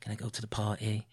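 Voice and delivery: quiet, low thinking aloud; inner monologue. Retold or imitated. Co-host imitates Donna's inner thoughts, quiet inner monologue. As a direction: murmur it like private thoughts, imitated. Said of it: "there's a lot of inner monologuing".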